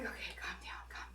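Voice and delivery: whispered self-calming; calming herself. Retold or imitated. Woman calming herself before a fight, whispering. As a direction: whisper it to yourself to stay calm. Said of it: "I was doing the whole woosah thing".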